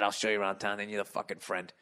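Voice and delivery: muttered "I'll show you around town" gone limp; flat. Shown as a demonstration. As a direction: let it drop as the friend-zone line. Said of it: "I'll say that".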